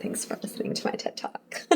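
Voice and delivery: soft, breathless throwaway whisper; whispered sign-off. Real, not acted. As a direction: toss it off under your breath. Sign-off quip after the rant.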